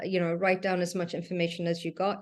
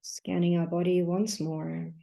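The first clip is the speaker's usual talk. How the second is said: guided meditation. Acted. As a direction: guide them gently through each breath, leaving long silences. Leads a breathing meditation.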